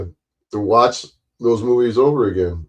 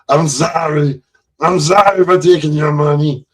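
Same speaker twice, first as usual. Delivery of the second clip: higher, playful Pee Wee apology; quoting the movie line in a higher voice; Pee Wee Herman impression; 6 semitones higher, 4 dB louder than usual. Streamer quoting Pee Wee to chat, higher playful voice. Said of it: "my impression of Pee Wee Herman".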